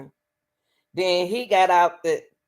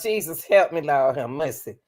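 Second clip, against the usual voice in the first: ordinary talk, nothing marked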